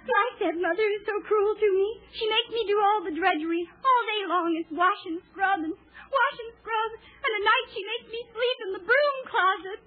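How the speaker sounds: hammed-up weepy princess; mock lament. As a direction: wail it like a melodrama heroine.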